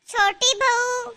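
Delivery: slow, stretched-out call. Acted. Mother-in-law with a towel to wash, calling out. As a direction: summon someone from another room.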